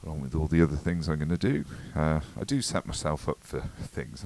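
ordinary delivery

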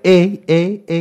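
high, loud mocking eh eh eh; mocking cousins. Jamaican cousins taunting him for saying eh, high mocking chant. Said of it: "they're all like".

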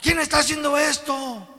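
high, loud panicked cry; shouting Philistines. Acted. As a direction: yell like a frightened crowd. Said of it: "y gritaron".

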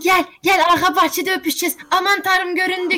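fast, high giggly rush; excited secret lover. Pretend. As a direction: rush it like caught sneaking. Acting secret lovers caught.

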